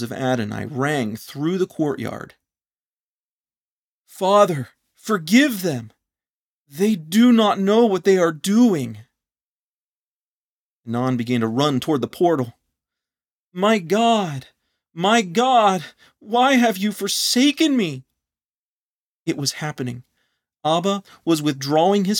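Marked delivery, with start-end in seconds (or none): 4.0-9.0 high, loud, slow plea for mercy; anguished cry. Acted. Adonai on the cross crying out to the Father, loud and slow. 13.5-18.0 high, loud, despairing cry; anguished cry. Acted. Adonai crying out to God, forsaken and anguished.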